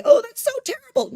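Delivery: high, loud mock dismay; exaggerated outcry at something supposedly terrible; mock progressive. Imitating a progressive reacting to the Haggadah, mock-appalled. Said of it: "which the progressive might say".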